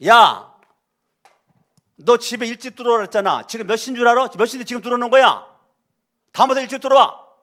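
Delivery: put-on loud, high, rushed scolding of a late child; angry scolding parent. Shown as a demonstration. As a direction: yell at the kid for coming home late. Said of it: "유 메시지는". (늦게 온 자녀에게 높고 큰 소리로 다그치는 부모)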